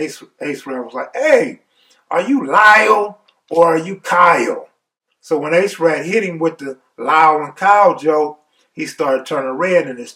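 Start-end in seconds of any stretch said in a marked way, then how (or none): 1.2-4.7 clowning. Retold or imitated. Cellmate clowning on Lyle, loud mocking taunt. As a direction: heckle him in a raised mocking voice. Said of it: "Ace Rat started clowning".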